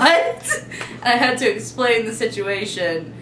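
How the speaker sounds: ordinary talk, nothing marked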